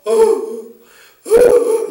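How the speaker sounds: drawn-out imitated sob; demonstrated crying aloud. Shown as a demonstration. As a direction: wail out loud to show real weeping. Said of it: "이렇게 소리내서 우는 거예요".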